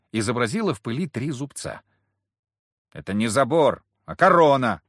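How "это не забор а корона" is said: loud, dismissive correction; scornful snort. A narrator's reading. Gang leader corrects the girl, scornfully. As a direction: correct it with scorn, louder on the last word. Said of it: "фыркнул вожак".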